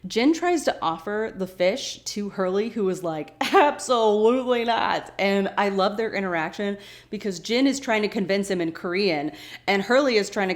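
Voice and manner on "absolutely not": slow, loud, raised-pitch refusal; big drawn-out mock refusal; emphatic refusal. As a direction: refuse loudly, stretching the words. Acting out Hurley's emphatic refusal.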